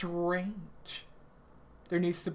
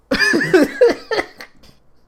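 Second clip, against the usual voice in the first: loud high laugh; 14 dB louder than usual. Real, not acted. Laughs at own outburst.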